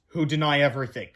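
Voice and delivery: ordinary delivery, nothing marked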